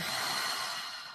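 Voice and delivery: long, soft exhale; audible cleansing breath; releasing sigh. Shown as a demonstration. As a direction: breathe out slowly to let it go.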